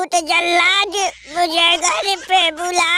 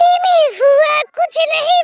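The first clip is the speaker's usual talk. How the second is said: much higher, louder outburst; mock exasperated cry; 10 semitones higher, 5 dB louder than usual. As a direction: throw it out in hammed-up despair. (ऊँची, झल्लाई हुई चीख)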